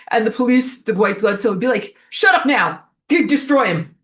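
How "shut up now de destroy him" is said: a louder, higher cop giving orders; shutting the punk down hard; mock stern enforcer.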